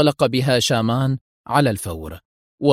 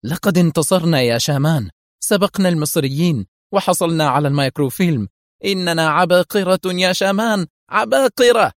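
high, triumphant exclamation building to a peak; jubilant cry; 6 semitones higher, 4 dB louder than usual. A narrator's reading. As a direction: crow with glee over a victory. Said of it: "وصاحت وهي ترفع القاعدة العاجية إلى أعلى في جذل". (صيحة انتصار جذلة)

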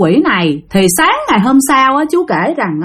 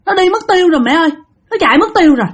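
put-on high child reporting to mom; four-year-old child; 7 semitones higher, faster than usual. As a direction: tell mom in a small, high voice. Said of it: "đứa con mới nói rằng". (giọng bé bốn tuổi cao, báo tin)

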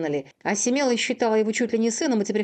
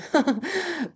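high, broken hysterical laugh; 7 semitones higher than usual. Acted. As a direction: laugh like you are cracking up. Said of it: "истерически расхохотался".

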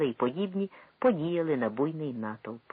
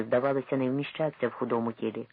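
ordinary delivery, nothing marked

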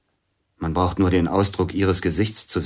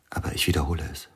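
whisper; 4 semitones lower, 6 dB quieter, much faster than usual. Acted. Intimate whispered confession scene.

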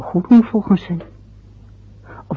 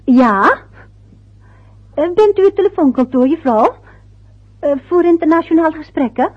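much higher, louder telephone voice; raised phone voice; 8 semitones higher, 6 dB louder than usual. Acted. Wife asks the operator on the phone, raised high voice. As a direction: speak up into the receiver.

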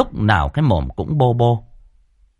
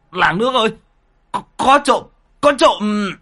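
much higher alarmed cry of thief; calling for help; 13 semitones higher than usual. A narrator's reading. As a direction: call out to the whole village in alarm. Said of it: "thì kêu lên". (kêu to hoảng hốt có trộm)

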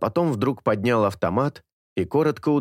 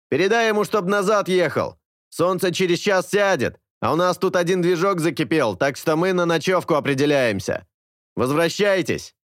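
much higher, louder shout over radio; walkie-talkie voice; 9 semitones higher, 3 dB louder than usual. A narrator's reading. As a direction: bark orders through a crackling radio.

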